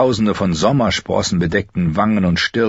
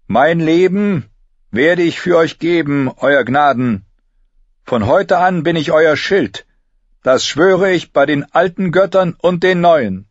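higher, louder proud vow; 10 semitones higher, 5 dB louder than usual. A narrator's reading. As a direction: swear loyalty with pride. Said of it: "in ihrer Stimme schwang Stolz mit".

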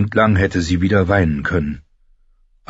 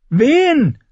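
much higher shouted question; Arya shouting; 4 dB louder than usual. Acted. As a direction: yell it mid-run. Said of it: "schrie sie im Laufen". (hoch geschriener Ruf)

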